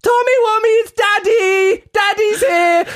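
very high, loud, slow sing-song dad call; effeminate dad greeting. Retold or imitated. Dad calls out to his son at school pickup, very high and effeminate. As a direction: camp it up like a dad waving from the car. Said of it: "wave at me very effeminately and be like".

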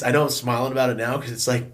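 usual speaking voice

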